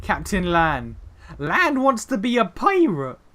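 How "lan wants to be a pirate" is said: higher, louder, slow and charmed; delighted. Real, not acted. Player delighted by Lan's wish, charmed and excited. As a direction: savour it with delight. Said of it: "that's kind of adorable".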